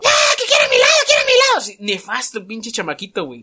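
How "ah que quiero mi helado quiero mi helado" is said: put-on high, loud bratty child wail; imitating a crying boy demanding his ice cream; whiny crying child. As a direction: wail like a spoiled kid wanting ice cream. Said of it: "Y el niñito, güey, llorando".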